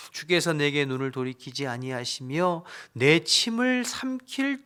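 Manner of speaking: dramatic lament reading of Job; loud, earnest scripture reading. Acted. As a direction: read the verse as Job's anguished plea. Reads Job's lament aloud.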